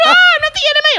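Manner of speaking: very high, loud, squeaky plea; panicked denial. Retold or imitated. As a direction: squeal it like a scared recruit. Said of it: "I said".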